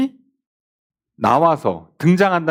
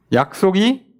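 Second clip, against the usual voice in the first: mocking question; 3 semitones higher, slower than usual. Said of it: "이렇게 질문하는 거죠".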